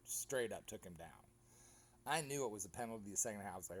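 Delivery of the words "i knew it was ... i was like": ordinary talk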